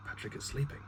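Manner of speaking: lightly hushed, half-whispered warning. A narrator's reading. Lucille warns Sharp the baby sleeps, hushed. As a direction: hush it gently for a sleeping baby. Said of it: "put a finger to her lips".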